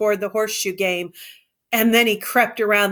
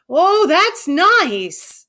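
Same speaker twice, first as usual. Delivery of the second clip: put-on higher, louder, very slow childlike delight; rapt Lenny; 9 semitones higher, 6 dB louder, much slower than usual. A narrator's reading. Lenny stroking harder, higher and more rapt. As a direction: lose yourself in the pleasure, stretching the oh.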